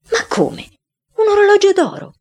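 molto più acuto e forte, incredulo; stupore. A narrator's reading. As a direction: esclamalo sbalordito. Family discovers gold watch. (famiglia stupita davanti all'orologio rotto, incredula)

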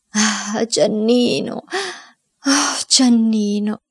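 slow, drawn-out wailing lament. A narrator's reading. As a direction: moan the name like a mourning cry. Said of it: "ripetendo come un lamento". (lamento lento e straziato)

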